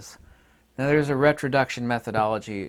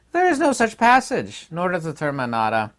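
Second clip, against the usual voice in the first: much higher and louder in indignant denial; forceful rebuttal; 7 semitones higher, 7 dB louder than usual. Real, not acted. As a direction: shoot the claim down hard.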